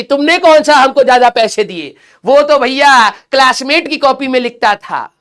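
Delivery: put-on sulky kid lashing out at mom and dad; mock blaming parents. Student blaming his parents in a loud, whiny mock voice. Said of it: "parents में भी".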